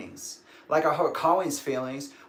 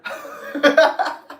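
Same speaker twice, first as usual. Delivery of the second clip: laugh; 13 semitones higher, 7 dB louder than usual. Real, not acted. Laughs after the friend's comeback.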